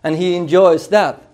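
ordinary talk, nothing marked

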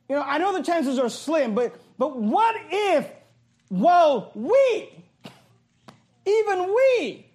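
high, drawn-out mock supposition; sarcastic. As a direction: stretch it slowly as if it were unthinkable. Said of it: "being spoken sarcastically".

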